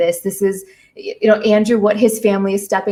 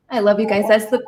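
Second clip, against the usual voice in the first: normal talking voice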